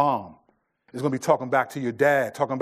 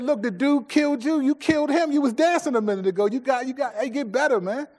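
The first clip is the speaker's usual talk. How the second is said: high, loud coaching pep talk; dad coaching his son through a lost game; 9 semitones higher, 4 dB louder than usual. Retold or imitated. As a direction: fire the kid up to do better. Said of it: "I said".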